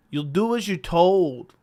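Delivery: slow, put-on Irish accent; Conor McGregor accent. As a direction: attempt an Irish accent, stretching the words. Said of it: "say that in that accent".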